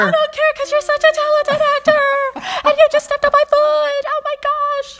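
gushing fan. Pretend. Playing the fan answering the actor, high gushing squeal. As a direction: gush over him like a swooning fan.

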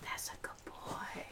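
barely audible pet praise; whispered praise. Real, not acted. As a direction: whisper it softly to the dog. Praising dog during stealth mission.

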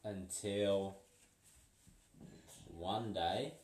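ordinary delivery

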